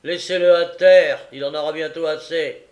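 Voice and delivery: loud, high command; overbearing magistrate; callous order. A narrator's reading. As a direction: issue the order harshly. Magistrate callously orders boy left.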